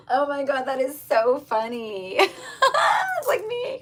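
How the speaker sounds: loud high laughing shriek; shrieking laughter. Real, not acted. Laughing at Monica hair.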